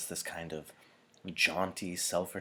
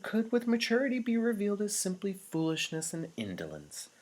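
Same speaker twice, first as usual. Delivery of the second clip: much higher, light and easy elder voice; lighter tone; 9 semitones higher, 3 dB louder than usual. A narrator's reading. Chief elder lightening the mood for the anxious crowd, light high tone. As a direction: ease the room with a light, airy tone. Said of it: "speaking now in a lighter tone".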